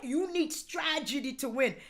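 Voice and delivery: ordinary talk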